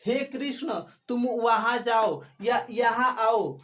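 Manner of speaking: normal talking voice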